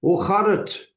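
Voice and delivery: bright, high Dutch hello; Dutch greeting. Real, not acted. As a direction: greet them cheerfully in Dutch. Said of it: "that's a bit of Dutch".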